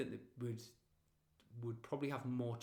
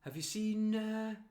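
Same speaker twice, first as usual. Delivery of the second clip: higher, dithering small-talk voice; chatty stranger; 5 semitones higher than usual. Retold or imitated. Mocking a neighbour who stops you for pointless chat. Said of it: "they go like".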